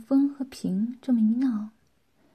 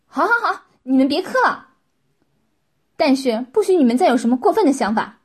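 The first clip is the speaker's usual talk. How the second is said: loud, higher-pitched giving in; exasperated command; 3 semitones higher, 10 dB louder, faster than usual. A narrator's reading. Master telling kowtowing servants to stop, loud and exasperated. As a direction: cut them off loudly, then warn them.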